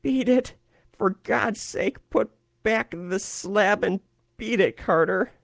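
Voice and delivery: high, desperate begging cry; piteous cry. A narrator's reading. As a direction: beg him to flee, pitifully. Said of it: "a piteous cry from Warren".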